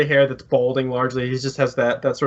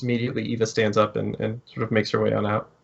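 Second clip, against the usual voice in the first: ordinary talk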